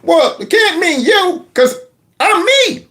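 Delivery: high, puzzled voice rising on me; baffled old man sure he is himself; confused Joe. Acted. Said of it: "That's how I would feel".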